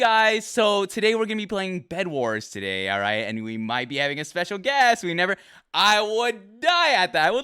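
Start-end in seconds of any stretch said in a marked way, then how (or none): 0.0-5.4 loud, high hype streamer intro. Pretend. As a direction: open a stream like a hyped host. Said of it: "I'm going to your stream and I just".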